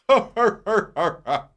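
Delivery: high, loud hammy cartoon hero laugh. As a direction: lean back and guffaw like an eighties cartoon. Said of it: "doing that eighties cartoon laugh".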